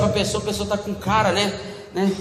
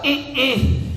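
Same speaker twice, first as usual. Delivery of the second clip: mock-sulky wordless refusal; 3 semitones higher than usual. Sulking partner refusing to speak up, sulky. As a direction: grunt instead of talking. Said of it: "que você tá emburrada".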